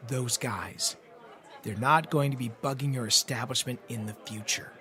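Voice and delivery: low hushed whisper; whispered back. A narrator's reading. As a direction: lean in and whisper it. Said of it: "I whispered back".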